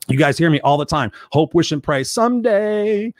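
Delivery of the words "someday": dreamy someday. Retold or imitated. Mocking wishful thinking.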